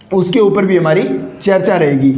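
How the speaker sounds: ordinary talk, nothing marked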